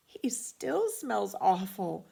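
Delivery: slow, disgusted mother voice; weary disgust. A narrator's reading. Mother finding the bathed dog still stinks, slow and disgusted. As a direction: drag it out, nose wrinkled.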